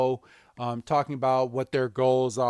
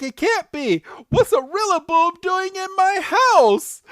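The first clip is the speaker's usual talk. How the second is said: high, loud, frantic questioning; panicked disbelief; 7 dB louder than usual. Retold or imitated. Go running from his room, high panicked squeal. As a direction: squeal it like a startled boy.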